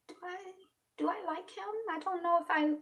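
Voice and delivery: soft, high musing to oneself; acted private doubt. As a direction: think it aloud in a small, high voice. Acts out her own doubts.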